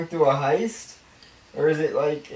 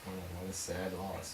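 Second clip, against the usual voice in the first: quiet, low mock grief; sarcastic sad; 10 semitones lower, 21 dB quieter than usual. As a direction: fake sorrow over a loss. Mock condolence.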